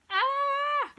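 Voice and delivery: high-pitched nervous "ah"; real jittery squeal before starting. Real, not acted. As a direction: let out a long, high squeal of nerves. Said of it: "I'm a bit nervous about this".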